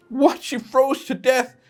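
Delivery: very high, put-on falsetto of outraged disbelief; shocked reader. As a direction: squeal in mock horror at the ending. Mock outrage at the sad ending.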